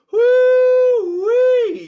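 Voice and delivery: long, loud high woo-wee cry; high whoop. Real, not acted. Coach whooping to celebrate the massive pump, high and loud. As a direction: let out a big cheering whoop.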